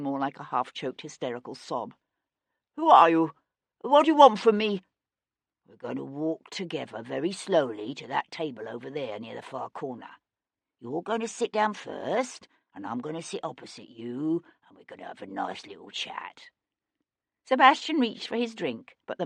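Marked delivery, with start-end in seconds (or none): 2.8-4.8 frightened. Acted. Bayard terrified at gunpoint. 5.7-16.5 low soft menacing voice; calm, quiet threat; quiet menace. Acted.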